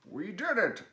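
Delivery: high, loud joyful cry; cried out. Acted. Frog cheering as the kite finally flies, high and loud. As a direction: burst out in triumph. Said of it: "cried Frog".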